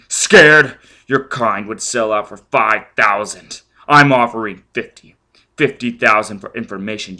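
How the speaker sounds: loud shouting, slowing at the end; furious threat. Acted. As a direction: spit each threat.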